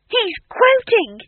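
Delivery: high, loud, slow shout. A narrator's reading. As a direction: yell it in amazement. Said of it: "he shouted".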